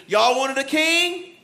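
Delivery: slow, stretched-out reproach; incredulous scolding of the people; indignant Samuel. Acted. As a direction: drag each question out, pitch climbing. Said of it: "He's talking like this".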